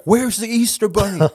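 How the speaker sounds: high-pitched, demanding mock kid cry; pushy children's demand, playfully imitated; imitating aggressive kids. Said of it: "like, almost aggressively".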